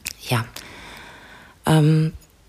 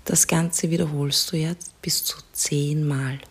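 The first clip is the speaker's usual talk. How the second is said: soft spa whisper; 5 dB quieter than usual. Acted. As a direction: whisper calmly, guide them to relax. Leads a guided relaxation.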